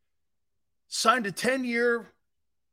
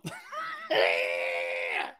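mock T-Rex roar; 13 semitones lower, 6 dB quieter than usual. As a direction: roar like a T-Rex over the contract. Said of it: "that's the noise Mike Brown's gonna make".

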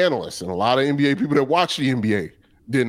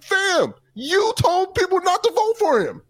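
high, pitched-up disbelieving retort; incredulous high retort. Retold or imitated. Calling out Perkins over his vote, in a high incredulous voice. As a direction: call him out in a squeaky high voice. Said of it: "I'm like".

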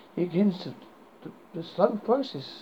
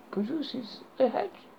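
plain reading voice

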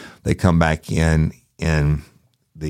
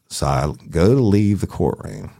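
normal talking voice

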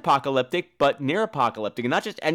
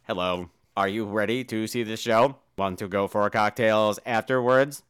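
lower, robotic speech-synth voice; robotic Hawking voice; 6 semitones lower than usual. Retold or imitated. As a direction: speak like a computer voice. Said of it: "he'd be like".

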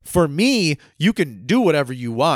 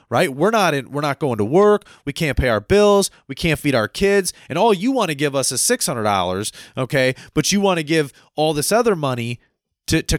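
usual speaking voice

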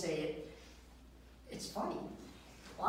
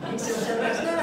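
louder sing-song tagline with a rising now; mock jingle; 5 dB louder, faster than usual. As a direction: punch it like the end of a jingle. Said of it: "it goes something like this".

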